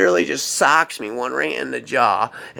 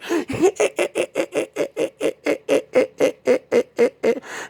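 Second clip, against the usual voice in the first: long high manic cackle; crazy laugh. Retold or imitated. Woman laughing as David runs for his life, manic cackle. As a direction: cackle madly in a high put-on voice. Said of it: "she was laughing".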